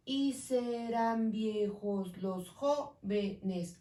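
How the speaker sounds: slow, syllables spaced; slow syllable count. Shown as a demonstration. Teacher counting syllables slowly for students. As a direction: count "Y serán viejos los jóvenes" syllable by syllable. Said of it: "Aquí tengo nueve".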